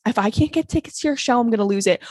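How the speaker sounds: normal talking voice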